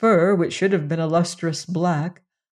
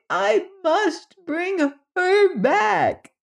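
very high, urgent insistence; a tiny creature demanding passage; desperate little alien; 10 semitones higher than usual. Acted.

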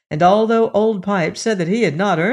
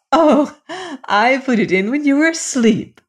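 bright, high laughing reply, louder on Oh; 7 semitones higher, 3 dB louder than usual. A narrator's reading. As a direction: tease him with a laugh. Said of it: "she said, laughing".

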